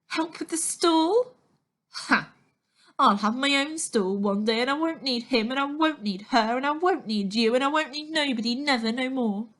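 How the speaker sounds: loud, high, scornful rant; defiant rant. A narrator's reading. Natasha scorning Stan's advice, loud defiant rant. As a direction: spit out every won't.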